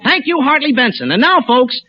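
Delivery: ordinary talk, nothing marked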